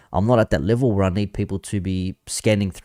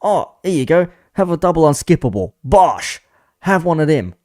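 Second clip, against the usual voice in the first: imitating Goldbridge; 7 semitones higher, 5 dB louder than usual. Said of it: "He goes".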